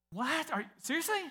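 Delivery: high, stunned disbelief; incredulous surprise. Retold or imitated. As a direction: pitch way up like he can't believe it. Stunned customer voiced in story.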